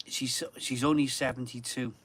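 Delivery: ordinary talk, nothing marked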